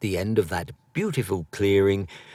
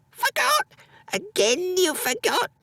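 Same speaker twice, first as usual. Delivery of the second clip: put-on ill old mother scolding. A narrator's reading. Sick mother scolding her son for forgetting the wood.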